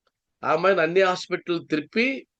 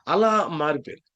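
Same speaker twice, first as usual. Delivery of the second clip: ordinary delivery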